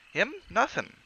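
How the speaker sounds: lightly voiced Cockney, high; Cockney shrug; Cockney bellboy. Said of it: "Thomas' Cockney dialect".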